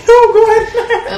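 high, slow, laughing mock challenge; laughing retort. Retelling the car fight, laughing challenge. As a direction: toss it back through laughter.

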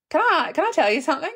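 quick, high, eager imitation of a bloke; quoting the guy. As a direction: rattle it off like a nervous guy. Voicing the guy at pub.

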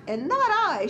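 high-pitched storybook shout; playful mild shout, pitch way up. A narrator's reading. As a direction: pipe up as the mouse, mildly shouting. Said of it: "shouted the mouse".

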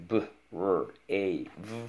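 B, R, A, V as separate letter sounds; sounding out. Shown as a demonstration. Teacher models spelling brave for pupil, sounding out. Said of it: "All right, let's go".

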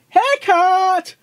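loud high squeal over a haircut; squeaky pony dismay. Acted. Pony shrieks at her own haircut, high and loud. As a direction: shriek about your hair like a vain filly.

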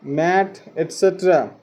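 ordinary talk

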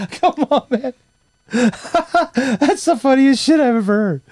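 high, slow and squeaky through laughter; can barely get the words out for laughing; laughing hard. Real, not acted. Cracking up at dad's story.

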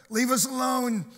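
high, loud yell out the window. Acted. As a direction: shout it like a fed-up passing driver. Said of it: "he yells out the window".

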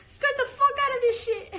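high, loud mock yell; high-pitched playful holler; playful shout. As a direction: squeal it out at full volume, playfully.

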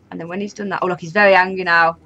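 ordinary talk, nothing marked

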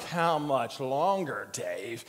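slow, low, drawn-out reproach; weary God. Acted. God looking at a slow disciple, wearily drawn out. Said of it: "God has to look at me like".

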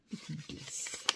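whispered under her breath to herself; whispered repeat. Real, not acted. Repeating the ice cream name to herself in a whisper.

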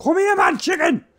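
shrill high shout; calling chickens. Real, not acted. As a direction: yell after it, very high. Chasing the chicken.

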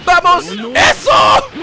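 loud, high shouts of triumph; excited cheering. Real, not acted. Player urging himself on mid-fight, excited cheering. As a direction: build from urging to a shouted celebration.

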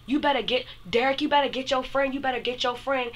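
high-pitched imitation of a furious friend; retold fury. Friend warning Derek about his friend, retold furious. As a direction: pitch it way up, mimicking an angry complaint. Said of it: "my friend starts getting furious".

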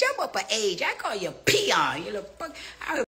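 usual speaking voice

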